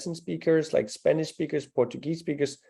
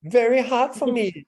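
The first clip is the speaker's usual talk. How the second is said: mock echo; 10 semitones higher, 6 dB louder than usual. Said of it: "echoing the student".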